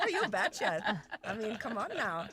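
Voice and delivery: loud, high mock Minnesota voice; Minnesota accent. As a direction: laugh through a hammed-up Minnesota accent. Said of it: "Minnesota salad".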